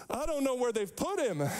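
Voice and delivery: a distressed mourner's cry, acted; live it out. Shown as a demonstration. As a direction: act out her distress as if standing there. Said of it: "live it out a little bit".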